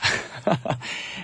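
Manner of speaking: short breath of laughter; amused chuckle. Real, not acted. DJ laughing at his own weird accent, amused chuckle. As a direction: chuckle at your own silliness.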